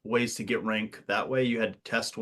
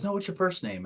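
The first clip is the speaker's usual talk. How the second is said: chummy officer voice; 9 semitones higher than usual. Retold or imitated. Commander voiced in army story.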